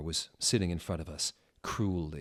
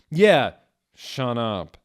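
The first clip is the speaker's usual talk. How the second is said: preppy; 4 semitones higher, 11 dB louder, slower than usual. Acted. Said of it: "Snake said preppily".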